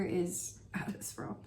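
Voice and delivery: reverent whisper; hushed rave. Real, not acted. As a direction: whisper it like it's sacred. Raving about a favorite scent.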